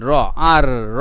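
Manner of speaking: American English, r said in full. Shown as a demonstration. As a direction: roll the r out American style. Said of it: "তাহলে American". (American উচ্চারণে পুরো র বলা)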